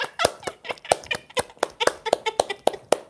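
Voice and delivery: high-pitched giggling fit; loud squeaky laughter; high squealing laugh. Real, not acted. Laughing after fly joke.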